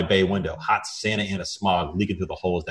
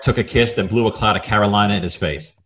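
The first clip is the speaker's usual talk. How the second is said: normal talking voice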